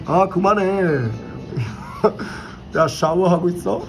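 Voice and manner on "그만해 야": ordinary talk, nothing marked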